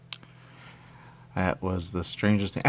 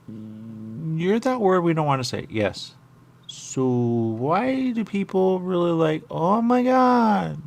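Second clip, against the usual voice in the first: slow put-on voice; 7 semitones higher, 3 dB louder, much slower than usual. Questioning a game character slowly.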